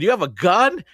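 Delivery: high, loud stunned question; shocked. Retold or imitated. Player retelling her reaction to the gun, shocked. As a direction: blurt it out in disbelief. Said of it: "I was shocked".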